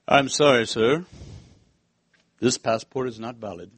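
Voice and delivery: low, stiff official voice; a hint of a stern border guard. Swiss border guard refusing the preacher's passport, low and stiff.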